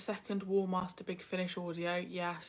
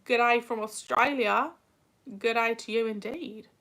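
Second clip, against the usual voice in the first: loud, higher hammed-up Australian accent; put-on Australian accent; 3 semitones higher, 7 dB louder than usual. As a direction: greet them in a mock Aussie accent. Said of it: "I apologise for my accent".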